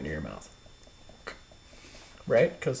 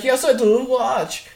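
higher, eager imitated voice; mock Swedish guy; 12 semitones higher, 4 dB louder than usual. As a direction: squeak it out as the lookalike. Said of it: "And he's like".

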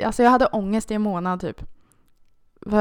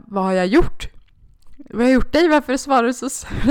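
tearful pleading; 4 semitones higher, 6 dB louder than usual. Retold or imitated. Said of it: "började störttjuta".

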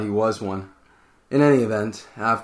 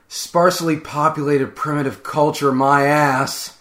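louder, higher, fed-up curse; exasperated outburst; 5 semitones higher, 7 dB louder than usual. A narrator's reading. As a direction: spit it out like a man chased too long. Tucker cursing the natives while fleeing.

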